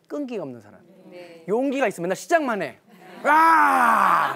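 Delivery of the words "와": loud cheering whoop; a big laughing cry of joy; excited shout. Acted. Crowd-style cheer in lecture.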